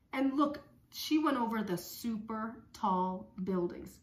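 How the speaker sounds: normal talking voice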